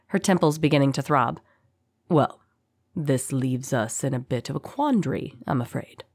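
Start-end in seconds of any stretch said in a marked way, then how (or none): 2.1-6.0 low, hushed and eerily composed; calm captor's voice sinking softer to the end; eerie calm. A narrator's reading. Captor considers what to do with his prisoner, eerie calm. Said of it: "said the voice with an eerie calm".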